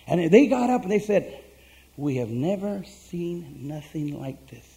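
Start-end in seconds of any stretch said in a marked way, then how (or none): 2.0-4.7 slow, hushed mock amazement; retold awe. Guests react to the praise tape, hushed retold awe. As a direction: imitate being awestruck, softly, word by word. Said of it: "They were both amazed".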